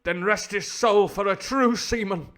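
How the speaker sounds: high, loud, slow declamation; hammed-up melodramatic Londoner; fifties Hollywood cockney. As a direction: declaim like an old-film hero honouring a shipmate. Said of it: "upper class Hollywood actor".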